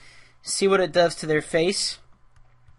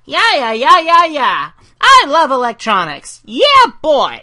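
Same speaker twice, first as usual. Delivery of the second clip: much higher and louder, a squeaky excitable little guy; high-pitched Gizmo; 7 semitones higher, 9 dB louder than usual. Acted. Creator voicing Gizmo for his animatic, high-pitched and loud. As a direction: chirp it like a hyper cartoon sidekick. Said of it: "kind of the high-pitched guy".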